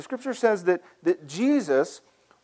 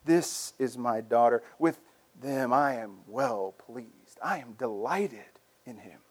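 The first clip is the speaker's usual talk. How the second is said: slow, lower, hushed voice of God; a lightly voiced God, tender and slow; 7 semitones lower, 6 dB quieter, slower than usual. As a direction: say it slowly and gently as God. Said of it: "the voice of God saying".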